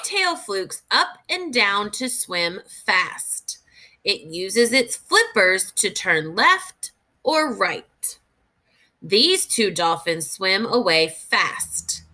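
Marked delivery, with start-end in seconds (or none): none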